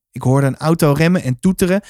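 ordinary delivery, nothing marked

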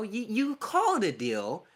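ordinary talk

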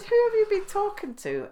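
very high, loud laughing question; mock outrage while laughing. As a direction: squeal it out laughing. Laughing mock indignant reply.

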